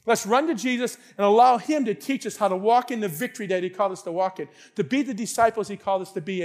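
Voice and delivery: normal talking voice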